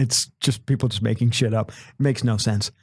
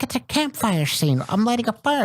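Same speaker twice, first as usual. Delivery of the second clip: mock director; 10 semitones higher than usual. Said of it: "It's like".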